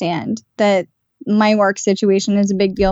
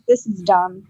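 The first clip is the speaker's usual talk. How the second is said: dismissive; 4 semitones lower than usual. Retold or imitated. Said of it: "I remember the energy behind it".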